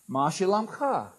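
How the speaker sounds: "Ma shalom cha" measured, with a long falling "cha"; teaching a greeting. Shown as a demonstration. Preacher models greeting for congregation to repeat, "Ma shalom cha" clearly spaced. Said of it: "You say it back to me".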